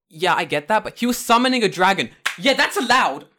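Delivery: high, loud mock official ruling; a referee gleefully overruling a protest; hammed-up referee. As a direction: play the smug tournament judge. Acting out tournament official's ruling.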